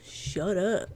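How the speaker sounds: ordinary delivery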